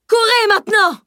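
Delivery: sudden high yell to flee; shouting. Acted. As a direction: bellow the order to run. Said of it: "hurla-t-il soudain".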